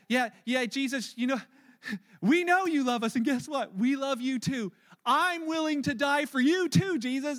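loud, high, overeager declaration; big-mouth Peter. Acted. Big-mouth Peter pledging his life to Jesus, loud and brash. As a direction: blurt it out with brash devotion. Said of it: "big mouth Peter, right away says".

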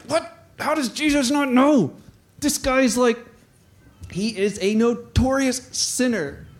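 high-pitched incredulous question; mock disbelief. Townspeople shocked at Jesus's company, mock disbelief. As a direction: imitate a scandalized onlooker.

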